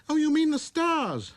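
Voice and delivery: put-on high, light boy's voice; little prince voice. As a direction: speak like a wondering small boy.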